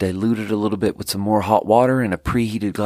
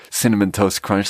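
ordinary talk, nothing marked

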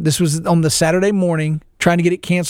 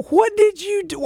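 much higher and slow, mock despair; dismayed high voice; slower than usual. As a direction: plead up high at the little son. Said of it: "I go".

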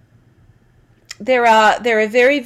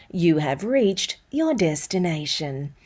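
low, soft navigation prompt, much quieter; GPS voice; 5 semitones lower, 6 dB quieter, slower than usual. Shown as a demonstration. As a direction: read it like a calm sat-nav instruction. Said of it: "and sentences like".

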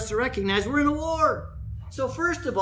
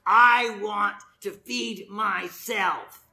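drawn-out, slow selfish declaration; mock selfishness; much slower than usual. Preacher voicing selfish behavior to the congregation, mock selfish. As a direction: act out self-centred greed, very slowly. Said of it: "All of those are selfish behaviors".

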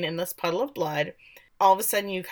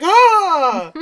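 sudden high, loud "Oh!" of shock; mock horror at the snap; shocked gasp; 10 semitones higher, 8 dB louder than usual. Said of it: "And I'm like".

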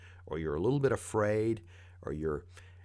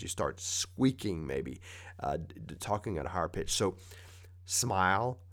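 ordinary talk

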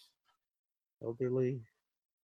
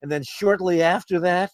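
mock interrogator; 6 dB louder than usual. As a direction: drag out each word of the accusation. CIA interrogator resumes.

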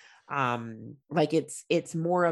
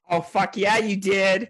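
louder, higher cheer of approval; hyped cheer; 4 semitones higher, 6 dB louder, slower than usual. Real, not acted. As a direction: cheer your buddy on. Cheering on friend's story.

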